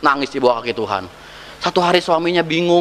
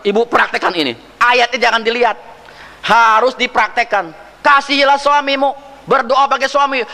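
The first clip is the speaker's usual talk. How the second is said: pastor's command; 9 semitones higher than usual. Retold or imitated. Nasihat pendeta ditirukan.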